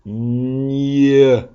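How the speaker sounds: "Gne" said slowly with clear mouth shapes; gne sound. Shown as a demonstration. Teacher showing the class how to say "Gne", exaggerated mouth. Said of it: "regardez les lèvres".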